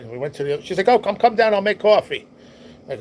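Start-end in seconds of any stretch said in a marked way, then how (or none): 0.9-2.3 high, loud motherly call; retold mother's high call; calling out invitation. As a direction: holler for them to join you. Mother calling him to come down.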